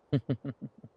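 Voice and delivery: fading predatory giggle; sinister chuckle. Acted. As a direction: chuckle to yourself, letting it fade. Vampire amused by captive prey.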